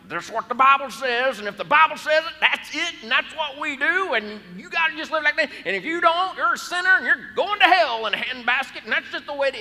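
high, loud, fast mimic of a hardline churchgoer; preachy legalist voice. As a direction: hammer out the rules like a scolding zealot. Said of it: "you gotta do this with that voice".

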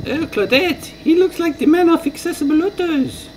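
very high, squeaky mock French lady; hammed-up French accent in a falsetto; terrible French accent. Second French lady recognises the YouTuber, falsetto mock French accent. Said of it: "my terrible French accent".

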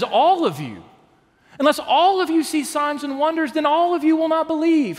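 rising preacher's emphasis on all of you; stress on all of you. Shown as a demonstration. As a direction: hammer all of you home from the pulpit. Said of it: "but he says".

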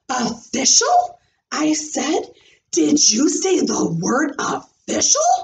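rising, eager question; perked-up surprise. Acted. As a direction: pounce on the word. Ears perked up.

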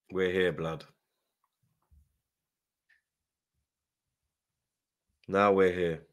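low, subdued resignation; dejected. Real, not acted. Fan who should be in the final, dejected. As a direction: sunk low, with long gaps. Dejected entering extra time.

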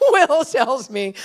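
laughing through the words, slowed down; chuckling while getting the words out. Real, not acted. Pastor to the church, laughing as he retells Will's joke.

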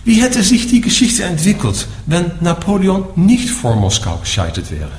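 ordinary talk

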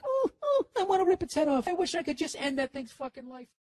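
high, whimpering dog with murderous wishes; crying dog voice. Acted. Dog crying at a squirrel through the window, mock whining. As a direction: whine it high, starting in little whimpers. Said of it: "Is it crying like".

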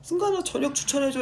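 high, loud request; eager fan. Retold or imitated. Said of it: "이래가지고".

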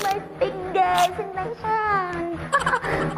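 high laughing fake fright; laughing mock scream. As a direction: shriek then laugh it off. Shark bites her hand.

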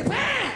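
high, shouted bam blast; a sudden explosion yelled out. Retold or imitated. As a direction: slam the word out like a blast. Mimics violent toilet blast.

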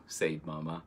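usual speaking voice

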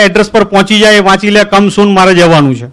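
ordinary delivery

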